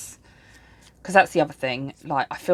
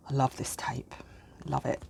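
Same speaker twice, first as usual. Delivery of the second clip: whispering; 3 semitones lower, 8 dB quieter than usual. Real, not acted. Whispered fond aside.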